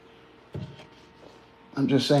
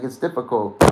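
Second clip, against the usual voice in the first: ordinary delivery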